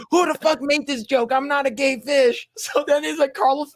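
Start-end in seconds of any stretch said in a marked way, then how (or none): 0.1-2.5 angry [name]. Retold or imitated. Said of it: "And then he's like".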